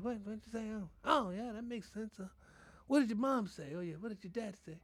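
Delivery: a hint of an easygoing grandparent; imitating grandparent. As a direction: grandparent agreeing with grandkid against parents. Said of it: "that's how grandparents talk".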